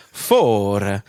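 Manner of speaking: loud, confident attempt at an Italian accent; fake Italian accent. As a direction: belt it out like a would-be Italian. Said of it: "say it in an Italian accent".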